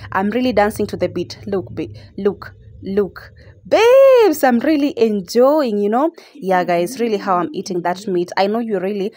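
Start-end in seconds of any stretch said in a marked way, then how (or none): 3.7-6.1 excited call. Real, not acted. Calls out while tipsy eating.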